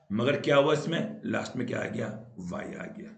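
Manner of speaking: ordinary talk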